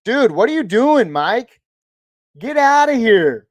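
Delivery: loud, high, excited yell at a viewer; playful stunned shout; excited outburst. Real, not acted. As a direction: shout out in disbelief at the gift. Reacting to a donor's gift.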